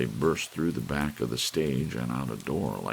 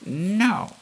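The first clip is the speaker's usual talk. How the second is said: alarmed no; 9 dB louder than usual. Acted. Old lady dreading bad news.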